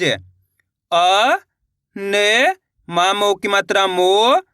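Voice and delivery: slow separated letters, long rising मो; syllable-by-syllable reading. Shown as a demonstration. Teacher leading learners to repeat along, slow and drawn out. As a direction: hold each sound, then pause. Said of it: "मेरे साथ साथ इनको repeat करना है".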